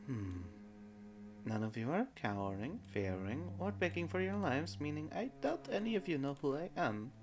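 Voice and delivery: soft, proud goddess, a touch haughty; regal goddess voice. Acted. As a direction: speak down to mortals, quietly. Goddess speaks to party.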